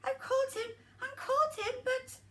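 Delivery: high little elf voice; lightly voiced little old elf.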